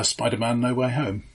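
ordinary talk

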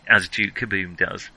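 usual speaking voice

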